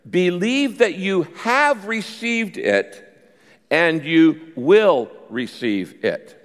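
slow, with "have" and "will" stretched and punched; deliberate reading, weight on "have" and "will"; stress on have and will. Shown as a demonstration. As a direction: lean hard on "have", then on "will". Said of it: "emphasize the word have".

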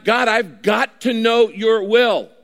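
high, loud, slow insistent prayer; urgent plea. Pretend. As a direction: press God for an answer. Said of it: "But when you say".

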